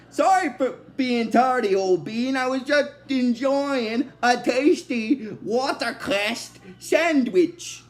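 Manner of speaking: hammed-up British accent, drawn out. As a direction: put on a stagey British gentleman. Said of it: "he starts talking in a British accent".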